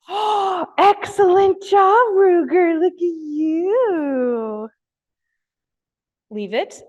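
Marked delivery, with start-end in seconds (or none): 0.1-4.7 very high, slow, drawn-out praise; over-the-moon dog praise; thrilled praise. Real, not acted. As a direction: reward the dog with pure delight. Dog succeeds at leave it.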